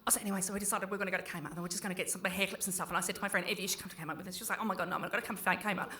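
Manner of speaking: put-on fast mumbled teen chatter, very quiet; imitating teenage daughter. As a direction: rattle it off quick and low like a mumbling twelve-year-old. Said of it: "She's like".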